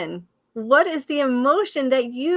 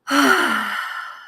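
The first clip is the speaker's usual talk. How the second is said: expressive sigh; 3 dB louder than usual. Shown as a demonstration. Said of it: "I'm gonna give my, my expression of".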